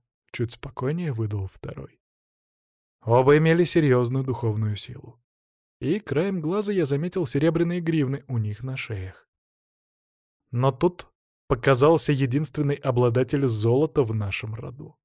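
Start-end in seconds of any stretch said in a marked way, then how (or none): none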